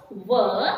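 W as an isolated "wuh"; letter sound of W. Shown as a demonstration. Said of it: "It sounds". (W ಅನ್ನು "ವ" ಎಂದು ಉಚ್ಚರಿಸಿ)

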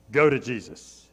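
ordinary talk

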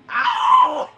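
very high, very loud ow; piercing yelp of hurt; pained yell. Real, not acted. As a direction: shriek as if just struck. Character hit in game.

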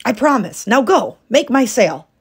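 impatient order. A narrator's reading. Husband dismissing his wife to the loom, loud and impatient. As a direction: send her off briskly.

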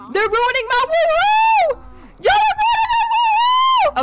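very high, slow, loud wail; frustrated shout. Real, not acted. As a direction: wail at them ruining it.

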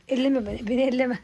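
usual speaking voice

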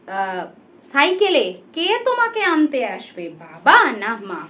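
unhurried questions, a pause after each; slow, with pauses. Shown as a demonstration. Teacher repeats the questions for pupils, slowly. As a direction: let each question land before the next. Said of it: "এবারে আস্তে আস্তে বলি".

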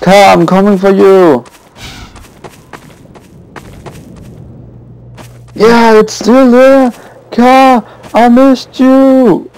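high, tender voice to a car; mock tender love talk. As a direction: croon it like a reunited lover. Reunion with the car.

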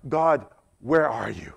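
a hint of quiet, aching loneliness; lonely plea to God. Believer alone asking God where he is, mildly forlorn. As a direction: ask softly, as if abandoned. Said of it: "See if you've ever asked these questions".

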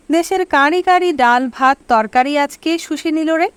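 ordinary delivery

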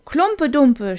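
high, loud eager shout; children shouting. A narrator's reading. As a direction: yell the other title back. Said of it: "schrien andere".

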